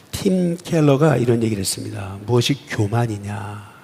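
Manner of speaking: normal talking voice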